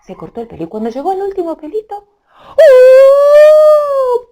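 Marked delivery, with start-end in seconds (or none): 2.6-4.2 high falling whoop. Acted. Falling as she shrinks.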